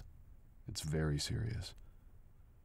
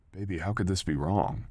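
louder, stress on "this"; passionate protest; 2 semitones higher, 9 dB louder than usual. Acted. Partner passionately telling girl the kiss wasn't wrong. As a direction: insist how could "this" be wrong.

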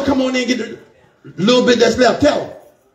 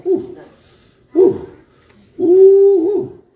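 high whoops; 10 semitones higher, 3 dB louder, much slower than usual. Real, not acted. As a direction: overcome "woo" cries, high-pitched. Overcome by the Spirit mid-sermon.

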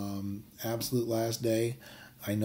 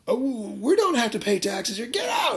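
high, loud indignant shout that climbs even higher at the end; hammed-up yell; 11 semitones higher, 5 dB louder than usual. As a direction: throw the tax collector out. Men refuse taxes, kick bird.

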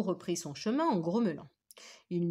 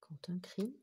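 hushed suspense whisper; storyteller whispering the scary moment; 7 dB quieter than usual. A narrator's reading. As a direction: breathe it to build tension. Suspense before the scream.

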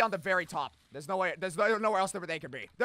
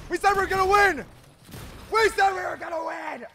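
frustrated yelling; 8 semitones higher, 3 dB louder than usual. Real, not acted. Teammate dying, frustrated yelling.

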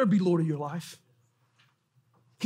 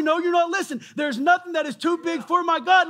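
insistent preaching; 7 semitones higher, 4 dB louder than usual. Acted.

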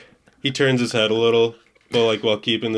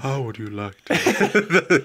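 slow, lightly voiced flirt; half-hearted sultry line; 4 semitones higher, slower than usual. Trying a [name] impression, slow and sultry. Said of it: "a [name] impression".

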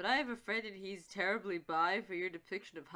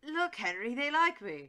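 bad Cockney, pitched up and louder; shitty Cockney accent; 3 semitones higher, 4 dB louder than usual. Hyde showing off to Henry, shitty Cockney accent. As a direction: put on a hammy, badly faked Cockney. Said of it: "This is my really shitty Cockney accent".

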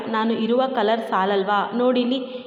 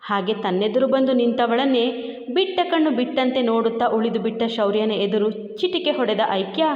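ordinary delivery

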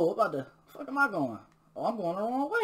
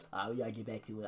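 normal talking voice

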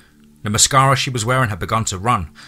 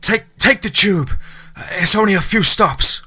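panting instructions; 11 semitones higher, 5 dB louder than usual. A narrator's reading. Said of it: "Charlie panted".

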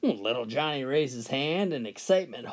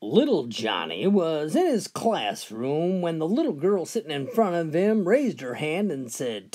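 ordinary talk, nothing marked

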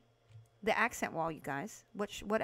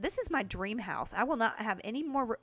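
usual speaking voice

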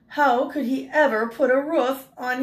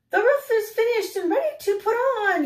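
much higher, calling out as the blue jay; 12 semitones higher than usual. Acted. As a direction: call it out like a little bird. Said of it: "he called".

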